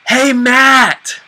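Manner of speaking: high, loud call across a mall. Pretend. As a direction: shout his name to get his attention. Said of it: "and you're like".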